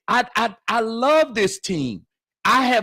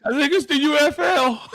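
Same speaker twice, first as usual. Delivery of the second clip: laughing; 9 semitones higher than usual. Retold or imitated. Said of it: "I said".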